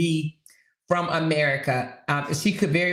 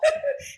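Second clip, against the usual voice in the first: high laugh. Real, not acted. Laughing after mocking haters.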